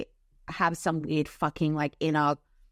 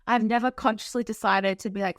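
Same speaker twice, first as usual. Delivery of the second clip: ordinary delivery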